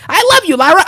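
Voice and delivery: high, gushing adoration; mock lovestruck squeal; gushing split personality. Acting wrestler's split personality.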